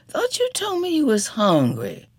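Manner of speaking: high, plaintive complaint; a hurt cry of disappointment; wailing. A narrator's reading. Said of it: "Janie wailed".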